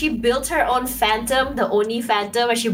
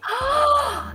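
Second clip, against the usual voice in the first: excited gasp. Real, not acted.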